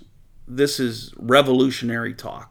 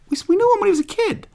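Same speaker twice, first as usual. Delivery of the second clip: much higher, fast, a lightly voiced incredulous neighbour; mock grumbling townsfolk; much faster than usual. Preacher voices the crowd doubting Jesus, high and quick. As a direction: play a villager who can't believe it, quickly.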